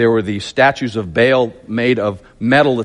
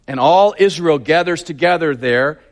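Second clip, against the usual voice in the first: ordinary talk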